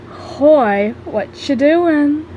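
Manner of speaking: slow, drawn-out silly greeting. A narrator's reading. Gaster greets the narrator out of character, silly voice. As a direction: play it goofy and drawn out. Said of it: "I have never heard him talk like that".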